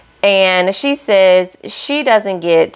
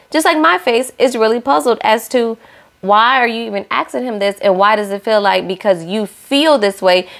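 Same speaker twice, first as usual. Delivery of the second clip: ordinary talk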